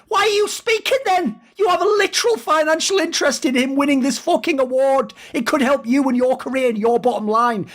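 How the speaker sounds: high-pitched incredulous retold rant at the agent; retold outburst. Retelling what he told the agent shilling his client, high incredulous outrage. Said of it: "I was like".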